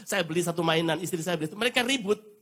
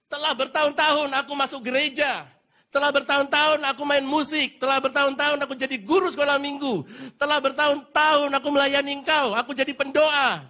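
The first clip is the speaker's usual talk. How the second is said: loud, higher, self-righteous protest; indignant complaint; 9 semitones higher, 6 dB louder than usual. Acted. Older son protesting loudly to his father, indignant. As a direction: list your years of service like a grievance.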